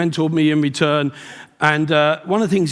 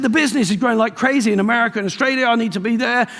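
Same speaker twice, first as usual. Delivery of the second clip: hurried protest; 7 semitones higher, faster than usual. Retold or imitated. Protesting to mentor.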